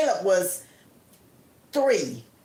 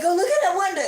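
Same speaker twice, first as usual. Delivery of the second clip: frightened high urging; urgent fearful plea; 8 semitones higher, much faster than usual. Retold or imitated. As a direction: push her to look, afraid. Screaming friend urges her.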